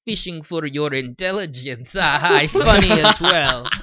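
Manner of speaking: put-on a light, joking Russian accent; a playful Russian spy in a Russian accent; mock Russian accent. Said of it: "terrible accent".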